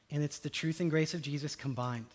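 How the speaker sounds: ordinary delivery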